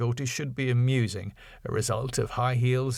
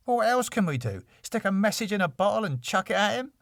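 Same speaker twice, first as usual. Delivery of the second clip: higher, quick sarcastic Dave; mock the idea as he says it; 9 semitones higher, faster than usual. A narrator's reading. Dave voiced in read script.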